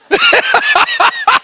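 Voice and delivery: quick high burst of laughter; a high delighted laugh. Real, not acted. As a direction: laugh with joy. Laughs again.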